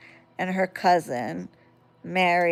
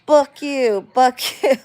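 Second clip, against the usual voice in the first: put-on kid's taunt; 5 semitones higher, 4 dB louder than usual. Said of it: "he'd be like".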